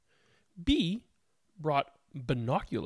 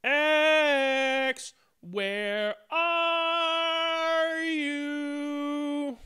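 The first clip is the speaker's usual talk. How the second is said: very slow, long-drawn call, higher; shouting call; 10 semitones higher, much slower than usual. A narrator's reading. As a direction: hold the call out as if calling far off. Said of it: "the letter shouted again".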